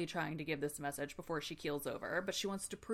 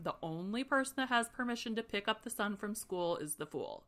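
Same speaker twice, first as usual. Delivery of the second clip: usual speaking voice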